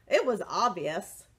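ordinary delivery

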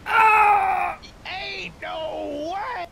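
high, drawn-out disbelieving wail; shocked cry. Real, not acted. Player shocked by what the boss does, shocked cry. Shocked by the boss's second phase.

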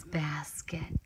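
soft whisper on the last word. Acted. Reader whispering to a child. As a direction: fade into a whisper. Reading alphabet story to child.

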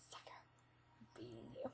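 gloating whisper. Real, not acted. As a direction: hiss it quietly to yourself. Whispered gloat mid-game.

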